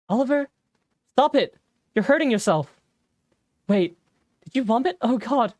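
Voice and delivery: high, loud panicked cries; alarmed shouting. Acted. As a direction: yell at him to stop, frightened. Friend finds Oliver hurting himself.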